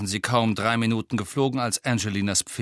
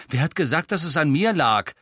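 loud, defensive snap; sharp, higher accusing question; snapping; 5 semitones higher, 5 dB louder than usual. A narrator's reading. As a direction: cut in and snap back. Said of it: "blaffte Ron sie an".

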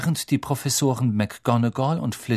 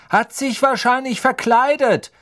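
much higher, louder knowing voice; fifth-year student; 13 semitones higher, 6 dB louder than usual. Acted. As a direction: toss out the guess confidently.

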